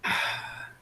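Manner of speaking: ordinary talk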